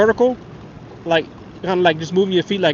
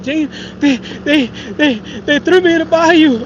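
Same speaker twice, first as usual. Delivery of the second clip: put-on high, tearful kid voice, louder at the end; tearful little boy; 11 semitones higher, 5 dB louder, slower than usual. Boy telling mama his brothers threw him in, high tearful plea. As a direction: play the scared boy blurting it out through tears. Crying child tells mama.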